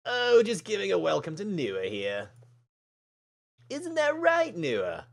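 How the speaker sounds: fake-friendly, lifted in pitch; syrupy put-on innocence; sickly sweet. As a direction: lay on the false sweetness. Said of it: "That sickly sweet tone".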